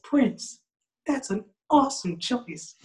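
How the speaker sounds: high, put-on pop star voice. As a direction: answer in a high-pitched star impression. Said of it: "[name]'s the type of dude".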